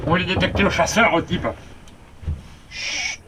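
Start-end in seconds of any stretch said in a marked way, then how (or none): 2.7-3.2 soft unvoiced shush; hushing. Real, not acted. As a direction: shush it gently. Calming a dog, hushing.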